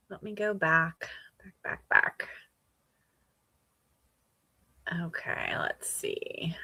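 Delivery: half-whispered muttering while scrolling back; muttering to herself. Real, not acted. Host searching stream comments, whispering to herself. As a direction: whisper it under your breath, mostly to yourself.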